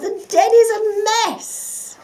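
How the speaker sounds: high, slow wail. A narrator's reading. Fox panics about his messy den, wailing. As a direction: moan it out in despair. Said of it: "he wailed".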